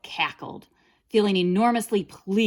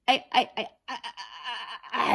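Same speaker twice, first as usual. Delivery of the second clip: stammered; 5 semitones higher than usual. A narrator's reading. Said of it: "gasped and stammered".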